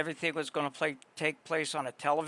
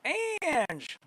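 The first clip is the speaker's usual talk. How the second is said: excited; 12 semitones higher than usual. Real, not acted. Greeting a returning viewer.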